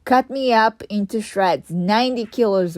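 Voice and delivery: usual speaking voice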